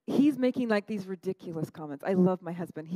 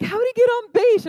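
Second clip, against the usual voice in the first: high and loud, honestly confused about baseball; baffled loud question; 14 semitones higher, 12 dB louder than usual. Retold or imitated. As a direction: shout the question like it makes no sense. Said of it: "And he's like".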